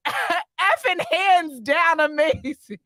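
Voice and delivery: stretched-out words with laughter around them; laughing read-out. Real, not acted. Laughs while reading a comment.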